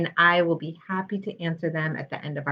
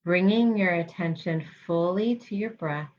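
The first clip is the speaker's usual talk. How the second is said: guided meditation. Real, not acted. Health psychologist guiding listeners through mindfulness practice, calm guided meditation. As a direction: lead them gently, leaving space between phrases.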